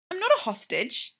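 high yelping protest; a high, startled denial. A narrator's reading. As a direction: yelp it out in alarm. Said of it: "Charles yelps".

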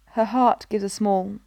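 ordinary delivery, nothing marked

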